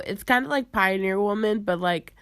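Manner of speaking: ordinary talk, nothing marked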